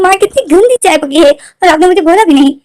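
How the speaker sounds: high, loud indignant complaint; indignant son. Retold or imitated. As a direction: accuse mother of not warning. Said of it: "वो बोलते हैं".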